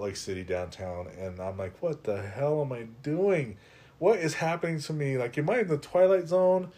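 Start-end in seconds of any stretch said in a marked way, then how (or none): 1.8-6.7 disbelieving, building higher and louder; mounting disbelief, pitch climbing; incredulous. Retold or imitated. Retold inner panic, rising.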